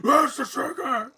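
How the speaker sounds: slow, high mocking quote of Where's the trigger; hammed-up gravel Batman; imitating Batman mid-laugh; mock Batman growl. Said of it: "I was laughing my ass off".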